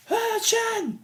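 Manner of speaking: very high hero cry; mock transformation call. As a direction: squeal it like a Ranger's battle call. Imitating Rangers' transformation call.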